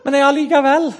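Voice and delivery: high, sweetly stubborn self-parody; hammed-up flower-loving softie; meek conscientious objector. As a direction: insist timidly, pitch rising. Meek objector answers interrogator.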